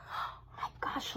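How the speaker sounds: soft breathy oh my gosh; amazed whisper to little listeners; whispered wonder. Pretend. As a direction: hush it like a shared secret. Reader marvels to child listeners.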